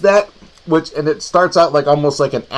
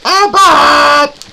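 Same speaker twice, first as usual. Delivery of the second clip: high, loud shout of the name; excited shout; 13 semitones higher, 8 dB louder than usual. Real, not acted. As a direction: call it out with a sudden burst.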